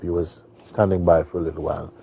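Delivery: ordinary delivery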